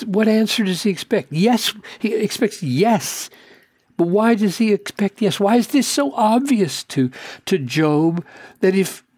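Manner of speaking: high, urgent preaching build; impassioned preaching. Real, not acted. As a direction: press the question hard from the pulpit. Preaching at rising intensity.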